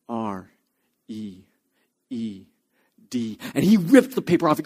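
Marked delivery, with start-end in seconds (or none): none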